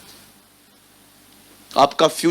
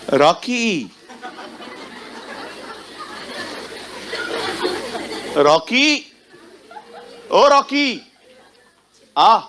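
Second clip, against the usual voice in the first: building call; 6 semitones higher, much slower than usual. Acted. Mother calling her son Rocky home, building to a high laughing call.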